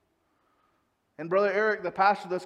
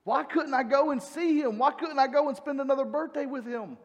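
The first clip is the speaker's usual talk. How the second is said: a mourner questioning God, gently raised; grieving question; 5 semitones higher than usual. Retold or imitated. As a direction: ask why, hurting from the loss. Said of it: "I began asking myself".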